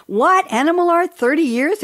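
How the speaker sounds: high, loud amazed exclamation. Real, not acted. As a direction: gasp out the wonder. Host amazed at guest's career.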